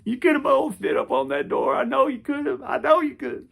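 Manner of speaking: hammed-up sobbing plea to a dead hero; mock crying. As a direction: wail it like a heartbroken fan. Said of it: "I was like".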